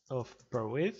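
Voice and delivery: French words said with a fake English accent; mock English accent. Tutorial host jokes with a fake English accent. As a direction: put on a silly English accent for the place name. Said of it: "avec un super accent qui ne veut rien dire".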